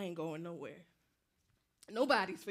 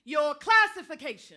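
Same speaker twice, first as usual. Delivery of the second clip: loud, high commanding bark; barked demand; 7 semitones higher, 16 dB louder than usual. Acted. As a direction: snap the order out. Soldier demanding answer.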